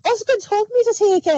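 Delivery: quick, high, put-on innocent voice; high innocent excuse. McGee caught by soldiers, pleading a high innocent excuse. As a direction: fib it out brightly, fast and high.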